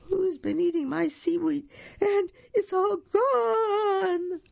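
put-on very high baby crocodile, wailing at the end; tiny little voice. A narrator's reading. Baby crocodile finds seaweed gone, tiny high wail. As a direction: squeak it, drawing out the final wail. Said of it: "a tiny little voice say".

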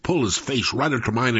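ordinary delivery

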